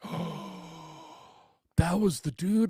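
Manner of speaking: gasp, then high, loud disbelief; starstruck. Retold or imitated. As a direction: gasp in sudden awed realization. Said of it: "starstruck in the moment".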